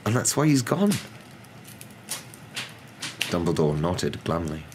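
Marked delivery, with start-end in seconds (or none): 0.0-0.9 high, trembling professor's voice. A narrator's reading. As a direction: tell grave news with a tremble, pitched high. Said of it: "Professor McGonagall's voice trembled".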